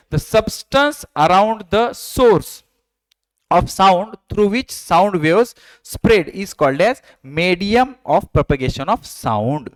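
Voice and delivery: very slow, word by word dictation; slow textbook reading. Acted. Teacher dictating a textbook line to the class, slow reading. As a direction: read each English word out slowly for students to copy.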